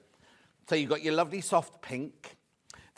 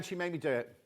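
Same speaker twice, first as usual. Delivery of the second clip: ordinary talk, nothing marked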